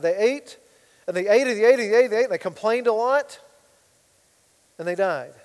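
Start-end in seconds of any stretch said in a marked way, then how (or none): 1.1-3.4 each ate pushed higher and louder, building; mocking build on ate. Preacher mocking Israelites' endless eating, building repetition. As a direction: hammer the repeated ate, rising with scorn.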